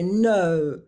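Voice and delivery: loud, drawn-out defensive no; defensive retort. Acted. Partner hitting back at the other in a fight, defensive. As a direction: push back hard with a long, loud no. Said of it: "we become quite defensive".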